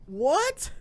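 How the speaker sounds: high, rising "what?" of disbelief; incredulous. Real, not acted. Reacting in disbelief to the post.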